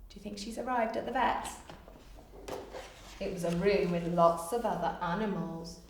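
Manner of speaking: plain reading voice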